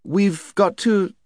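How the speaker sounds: put-on high, keen young girl; eager girl voice. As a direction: blurt it out eagerly. Jill voiced by narrator.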